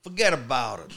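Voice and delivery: put-on Brooklyn catchphrase; badly faked New York accent; attempted New York accent. Shown as a demonstration. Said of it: "give us your New York accent".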